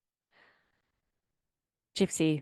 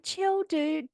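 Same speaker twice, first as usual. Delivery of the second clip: much higher mock-casual dude voice; mocking boyfriend; 10 semitones higher than usual. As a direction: lampoon him telling her to chill. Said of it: "He's like".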